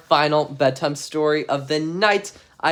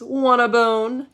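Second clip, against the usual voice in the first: higher, slow put-on dog voice; dog's question; 9 semitones higher, 4 dB louder, much slower than usual. The dog offering his bone, higher put-on voice. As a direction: ask it as the eager dog.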